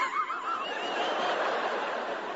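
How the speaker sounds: breathless squeak of laughter; helpless silent giggle; high wheezing laugh. Real, not acted. Laughs at own joke.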